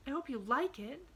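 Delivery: put-on high little-girl voice, "like it" lifted hopefully; rising inflection. A narrator's reading. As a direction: let "like it" swing upward like a question. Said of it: "a rising inflection in her voice".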